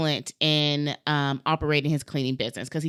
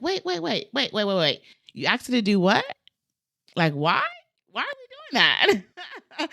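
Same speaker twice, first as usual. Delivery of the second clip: baffled wait wait; 6 semitones higher than usual. Retold or imitated. Client retelling his own shock, in high baffled disbelief. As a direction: caught off guard, pitch shooting up. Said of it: "I was very confused and baffled".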